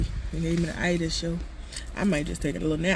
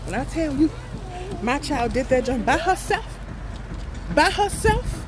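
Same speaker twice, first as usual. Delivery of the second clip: proud; 9 semitones higher than usual. Real, not acted. Proud bragging about child.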